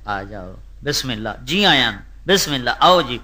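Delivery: quiet, hospitable "بسم اللہ"; soft welcoming. Pretend. Preacher voicing gracious hosts, quiet and welcoming. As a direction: welcome a guest softly. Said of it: "دو میں کھلوتے".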